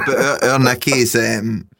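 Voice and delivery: ordinary delivery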